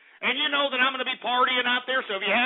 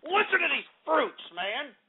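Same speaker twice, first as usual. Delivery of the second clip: ordinary talk, nothing marked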